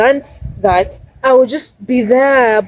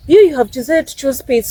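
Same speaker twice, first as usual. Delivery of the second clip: ordinary delivery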